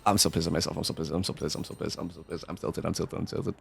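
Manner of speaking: low muttered self-scolding, fading to near silence; self-directed frustration. Real, not acted. Streamer berating himself after misclicks, low fading grumble. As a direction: grumble it to yourself, dying away.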